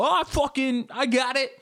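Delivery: high-pitched, cocksure boasting; cocky male ego. Acted. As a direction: puff it up with overconfident bravado. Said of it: "male ego that creeps in where you go".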